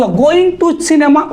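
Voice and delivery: reads the sentence out very slowly. Shown as a demonstration. Teacher reads the test sentence to students very slowly. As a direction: spells out each word at a crawl for the class.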